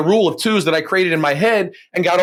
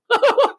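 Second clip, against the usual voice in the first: high laugh. Real, not acted. Laughs at his own irony.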